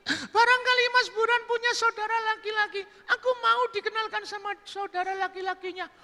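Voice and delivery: very high falsetto public address; woman's speech. Acted. Woman addressing the villagers, high falsetto speech. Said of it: "si wanita pelacur itu pidato".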